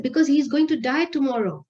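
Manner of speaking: retold in an innocent, high-pitched voice. Telling sister what Swami had said, very innocently. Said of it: "very innocently".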